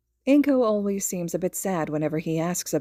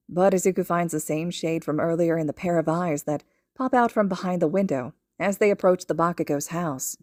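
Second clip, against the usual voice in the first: ordinary delivery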